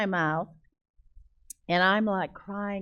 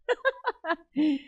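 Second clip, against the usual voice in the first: high burst of real laughter; amused laugh; 10 semitones higher than usual. Real, not acted. Speaker amused at her toddler comparison, amused laugh. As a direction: laugh at yourself.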